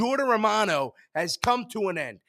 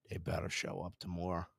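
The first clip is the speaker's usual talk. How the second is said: muttered warning; 12 semitones lower, 18 dB quieter, faster than usual. Real, not acted. Deflated fan muttering.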